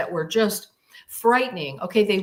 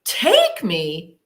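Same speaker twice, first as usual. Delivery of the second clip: high, loud, slow alarmed question; frightened outcry; 5 semitones higher, 7 dB louder, much slower than usual. Retold or imitated. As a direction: demand it in rising panic. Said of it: "And I said out loud".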